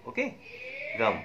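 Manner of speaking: ordinary talk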